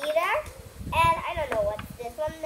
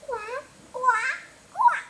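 rising quacks; 8 semitones higher, 4 dB louder, much slower than usual. Pretend. Child reading the duck riddle, quacks climbing higher and louder.

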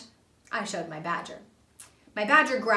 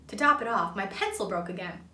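ordinary delivery, nothing marked

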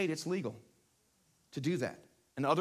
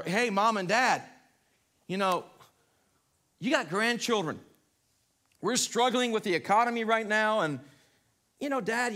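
put-on pleading grown child; 5 semitones higher, 5 dB louder than usual. Acting grown child persuading elderly parents.

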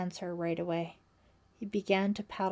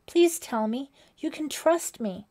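high, louder earnest appeal; pleading; 6 semitones higher, 7 dB louder than usual. A narrator's reading. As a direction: plead for his confidence. Frightened girl pleads with silent companion.

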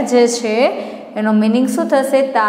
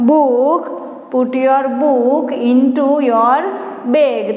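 slow rhyme reading; much slower than usual. Shown as a demonstration. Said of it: "તમે આ પહેલી લીટીમાં શીખી ગયા છો".

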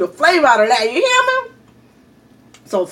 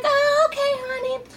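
sweet lady; 4 dB quieter than usual. Retold or imitated. Lady accepting answer sweetly.

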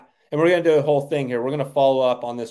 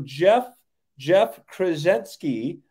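drawn-out winner name; 4 semitones higher, much slower than usual. Real, not acted. Announcing the grand prize winner.

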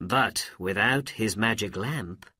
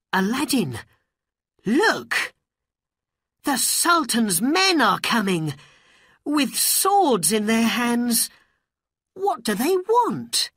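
high, loud, urgent alarm; alarmed warning; 12 semitones higher, 7 dB louder than usual. A narrator's reading. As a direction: warn your friend of danger, voice pitched way up. Friend sees armed men coming.

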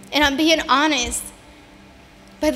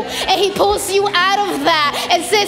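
high, building preacher's cry, louder toward the end; impassioned preaching; 5 semitones higher, 4 dB louder than usual. Real, not acted. Woman testifying to church about God's faithfulness, impassioned preaching. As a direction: preach it with rising fervor, drawing out key words.

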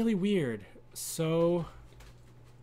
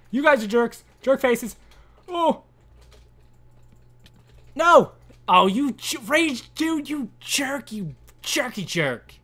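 playful high indignant yelling; pretend-furious at her teammates; hammed-up jerk insults, high and loud; mock outrage; 7 semitones higher, 6 dB louder than usual. Real, not acted.

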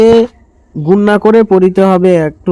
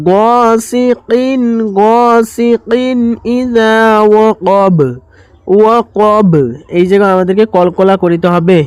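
Quran recitation; 2 semitones higher, much slower than usual. Shown as a demonstration. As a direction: recite it slowly, stretching the vowels. Teacher recites verse lines. (ধীর সুরেলা তিলাওয়াত)

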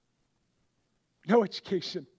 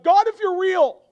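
crying out to God; 12 semitones higher, 15 dB louder, slower than usual. Retold or imitated. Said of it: "I cried out to God".

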